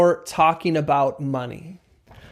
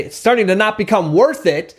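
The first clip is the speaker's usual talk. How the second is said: pushy, higher-pitched and firm, falling at the end; agitating; 4 semitones higher, 4 dB louder than usual. Shown as a demonstration. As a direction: punch it out as if to provoke. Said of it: "it's gonna agitate".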